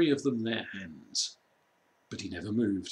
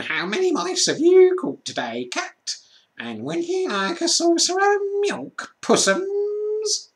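much higher, louder sneering voice; mocking creature; 7 dB louder than usual. A narrator's reading. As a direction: taunt in a sing-song sneer. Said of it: "jeering at him".

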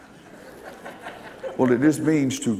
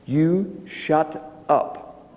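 drawn-out, very slow, higher rebuke; voice yelling; 4 semitones higher, much slower than usual. Retold or imitated. Inner voice of God rebuking the preacher, slow drawn-out yell. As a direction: stretch each word like a stern inner voice. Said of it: "a voice yelled".

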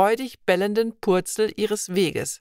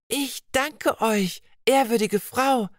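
put-on girl's voice lifted high with delight; beaming with joy; 4 semitones higher than usual. Vibeka thanks the old woman, beaming with joy. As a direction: thank her brightly, overjoyed. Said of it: "sagte Vibeka freudestrahlend".

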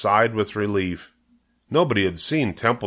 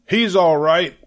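higher, louder hurried reassurance; cried out; 8 semitones higher, 5 dB louder than usual. A narrator's reading. Zuckerman crying out to the loudspeaker that his pig is fine. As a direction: cry out to calm the crowd. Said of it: "cried Mister Zuckerman".

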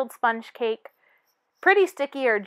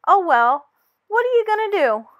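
acceptance; 6 semitones higher, 5 dB louder than usual. Acted.

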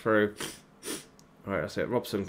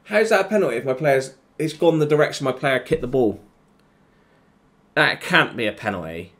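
higher, louder, fast protest; angry protest; 4 semitones higher, 4 dB louder than usual. Real, not acted. Gamer protesting a penalty decision, angry. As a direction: argue the call, outraged.

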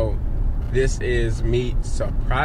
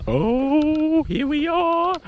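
high singsong arrival; much slower than usual. Real, not acted. Arriving at friend's house.

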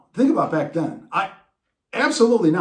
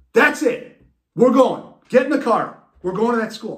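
loud, higher commanding dad; determined dad; 6 semitones higher, 5 dB louder than usual. Retold or imitated. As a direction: order the kid into the car. Dad decides to search.

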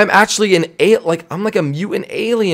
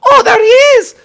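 high excited shout; 8 dB louder, faster than usual. Acted. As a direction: yell it out in delight. Spotting the character, calling out.

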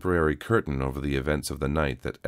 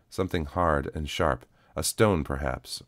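plain reading voice

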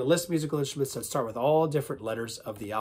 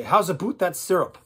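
loud, higher Minnesota accent; 4 semitones higher, 6 dB louder than usual. Shown as a demonstration. Accent warm-up where they say, Minnesota accent. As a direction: exaggerate the Minnesota vowels. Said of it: "how about Minnesota".

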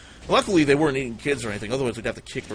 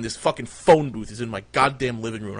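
ordinary delivery

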